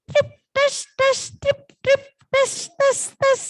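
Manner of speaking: high, staccato drips; imitated dripping. Storyteller demonstrates the third noise, high dripping water. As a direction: alternate tiny high drips and splashes. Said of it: "Yang ketiga ada suara lagi".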